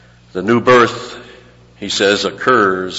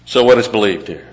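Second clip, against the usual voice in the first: usual speaking voice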